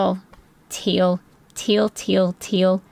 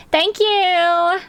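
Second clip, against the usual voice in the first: mock sweet thanks; 11 semitones higher, 4 dB louder than usual. Grateful reply after "Yes, ma'am.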